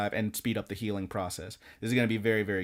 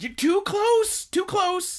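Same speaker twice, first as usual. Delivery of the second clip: much higher, slow squeaky creature voice; Nanachi's squeak; 5 dB louder, slower than usual. Retold or imitated. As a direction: squeak it out slowly like a small furry creature. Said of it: "And Nanachi's like".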